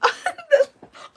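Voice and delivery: sudden high loud laugh; burst of laughter. Real, not acted. Streamer laughing loudly as a viewer's comment appears on cue. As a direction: laugh out in shock at the coincidence.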